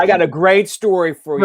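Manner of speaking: high, loud overblown build-up; mock hype. Host imitating a friend announcing a great story, hammed-up excitement. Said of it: "bringing so much hype to it".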